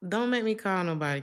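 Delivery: ordinary talk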